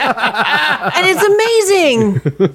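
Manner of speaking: laughing. Real, not acted. Gushing about the baking show.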